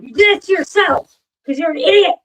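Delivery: a smug told-you-so scold; mock scolding. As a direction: jeer at them like they earned it. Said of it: "I'd be like".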